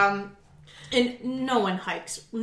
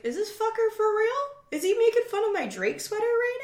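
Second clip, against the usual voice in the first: high, incredulous mock outrage; mock indignation; 12 semitones higher than usual. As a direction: play up your offended suspicion, half laughing. Indignant inner monologue retold.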